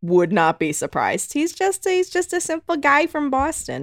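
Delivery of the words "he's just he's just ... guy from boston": put-on Boston accent, pitched up high; lightly put-on Boston accent. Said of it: "Is that your Boston accent?".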